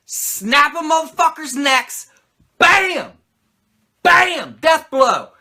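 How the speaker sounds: high, loud punchy sound-effect yells; hammed-up action-movie blows; mock action shouts. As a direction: bark out the hits like a movie fight. Acting out neck snap.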